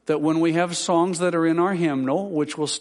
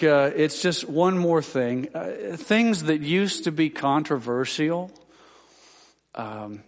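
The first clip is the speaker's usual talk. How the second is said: ordinary talk, nothing marked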